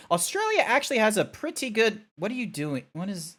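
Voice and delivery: normal talking voice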